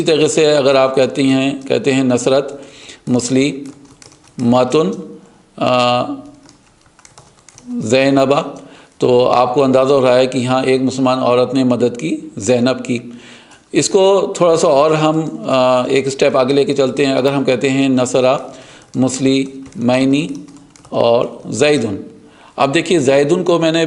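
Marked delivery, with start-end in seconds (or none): 2.0-8.4 slow demo. Shown as a demonstration. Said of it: "slow demo". 17.9-22.0 slow demo. Shown as a demonstration. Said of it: "slow demo".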